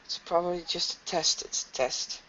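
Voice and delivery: soft near-whisper to yourself; small, quiet voice; hushed denial. Acted. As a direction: murmur it, trying to convince yourself. Character still whispering in denial.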